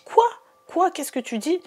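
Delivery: high, shocked disbelieving question, imitating; shocked cry. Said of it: "Et je crie et j'ai dit".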